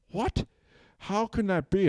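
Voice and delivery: high-pitched, incredulous outburst; shocked cow. Acted. Cow startled by the man's reaction, high incredulous voice. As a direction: squeak it out in acted disbelief.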